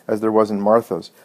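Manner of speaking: plain reading voice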